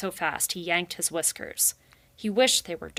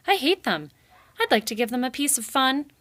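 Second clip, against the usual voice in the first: higher, louder angry hiss of hate; angry snarl; 7 semitones higher, 5 dB louder than usual. Acted. Rai snarling about the beavers. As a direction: let the hatred boil over. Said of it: "Rai snarled under his breath".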